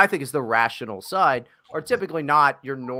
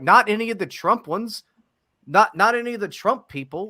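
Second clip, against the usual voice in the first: emphatic; 9 semitones higher, 4 dB louder than usual. Retold or imitated. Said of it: "I'm like".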